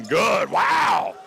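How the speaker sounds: loud burst rising high on the wow; punchy, high exclamation; amazed play-by-play call. Real, not acted. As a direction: shout it, wowed by the shot. Amazed at made three.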